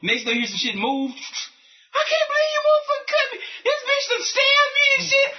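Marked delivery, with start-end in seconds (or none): 1.1-5.3 high crying shout, pitched way up; mock wounded yell. Stabbed husband yelling at wife on phone, high crying shout. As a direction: shriek it like the knife just hit.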